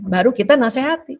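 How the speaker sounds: ordinary talk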